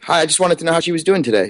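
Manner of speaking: normal talking voice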